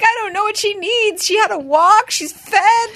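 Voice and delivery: very high, loud, fretful; frantic dog parent. Retold or imitated. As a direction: squeal in helpless worry. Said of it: "I'm like".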